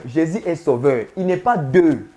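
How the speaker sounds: usual speaking voice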